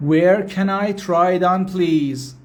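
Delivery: slow, carefully spaced English; slow teaching demo. Shown as a demonstration. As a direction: spell it out slowly for learners. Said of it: "teacher repeats phrase for learners".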